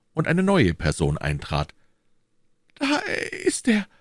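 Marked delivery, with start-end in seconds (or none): 2.8-3.9 high-pitched exclamation; crying out. A narrator's reading. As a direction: call it out high as you recognise him. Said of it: "rief Bonacieux". (hoher Ausruf)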